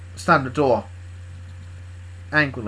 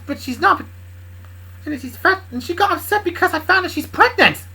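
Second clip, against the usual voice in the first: high, loud, rushed character voice; worried Bob; 7 dB louder than usual. Acted. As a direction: blurt out the worry in a raised voice. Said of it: "looks worried at Kendra".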